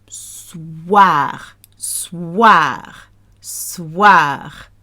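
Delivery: "Soir" drawn out as sss-wahr; slow French "Soir", long s then wahr. Shown as a demonstration. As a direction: stretch the WA in "Soir". Models wa in soir.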